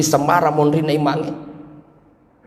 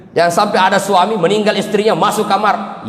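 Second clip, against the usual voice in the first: normal talking voice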